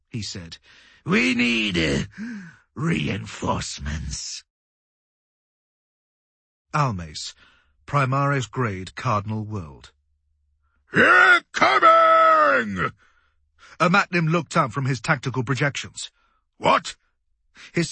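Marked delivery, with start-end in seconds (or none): 1.0-4.4 strained. A narrator's reading. Battered officer needs help. 10.9-12.9 high, long-drawn battle yell; warning shout. A narrator's reading. Crewman warning of incoming attack, shouting. 16.6-17.0 high, loud startled question; startled demand. A narrator's reading. Commander startled at his projections, sharp demand.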